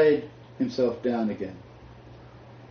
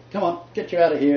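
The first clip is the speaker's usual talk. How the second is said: high, loud concerned farmer; a bush farmer checking on you; Australian farmer; 7 semitones higher, 5 dB louder than usual. Retold or imitated. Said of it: "he said".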